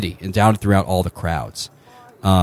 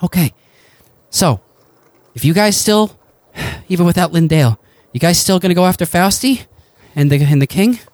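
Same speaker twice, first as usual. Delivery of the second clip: higher, searching ally; Joshua asking; 8 semitones higher than usual. Acted. Joshua asking the party to go after Fausti, lightly higher. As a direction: lift the pitch and ask it earnestly. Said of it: "he says".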